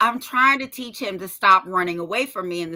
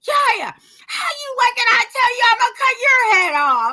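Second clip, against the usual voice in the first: imitated child yelling; 11 semitones higher, 5 dB louder than usual. Little boy repeating the warning in public, imitated yelling. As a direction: holler it like an alarmed little boy, hammed up. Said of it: "Now he's yelling this".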